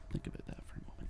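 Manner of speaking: fast, breathy whisper; hushed whispered repeat. Shown as a demonstration. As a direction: whisper it like a secret.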